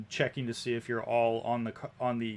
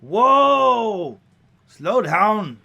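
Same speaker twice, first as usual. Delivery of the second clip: high, loud holler to the horses; woman yelling out; 9 semitones higher, 13 dB louder, much slower than usual. Acted. Carriage driver pulling up her horses, yelling out. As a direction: yell to rein in horses. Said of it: "a woman's voice yelling out".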